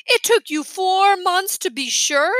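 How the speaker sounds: indignant disbelief. A narrator's reading. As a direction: needle his parents with scorn. Boy outraged at parents.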